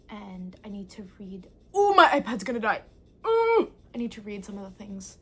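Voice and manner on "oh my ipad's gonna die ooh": loud surprised yelp; louder startled squeal; sudden alarm. Real, not acted. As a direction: jolt up in alarm.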